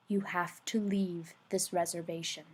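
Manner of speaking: low secret hush. A narrator's reading. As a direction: say it softly, like a secret. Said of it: "didn't even want the trees to hear".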